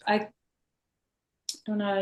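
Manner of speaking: ordinary delivery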